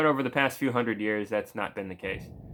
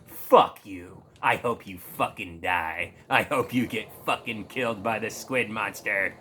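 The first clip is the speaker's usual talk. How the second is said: mock contempt; 4 semitones lower than usual. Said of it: "disgusting shithead look possible".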